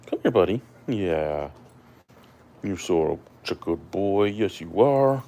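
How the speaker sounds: affectionate pet talk; talking to a dog. Real, not acted. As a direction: praise the puppy sweetly. Praising dog.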